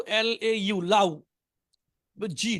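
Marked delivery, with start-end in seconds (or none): 0.8-1.2 লাউ read as written, rhyming with "cow"; low, literal reading of লাউ; spelling pronunciation. Shown as a demonstration. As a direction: sound out l-a-u as লাউ. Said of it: "লেখা আছে কি দেখেন তো".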